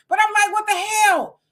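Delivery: high squealing laugh into a mock outraged question; amused outraged squeal; laughing disbelief. Real, not acted. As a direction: cackle then ask what the hell.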